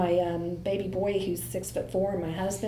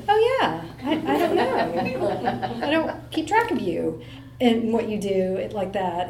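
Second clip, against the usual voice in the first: embarrassed laugh; slower than usual. Retold or imitated. Mom caught out by her son's question, laughing awkwardly. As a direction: caught out, giggle the excuse. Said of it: "And I'm like".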